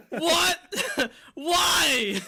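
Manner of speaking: high, loud disbelieving cries breaking into laughter; shocked yelling. Retold or imitated. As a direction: shout it in stunned outrage, laughing.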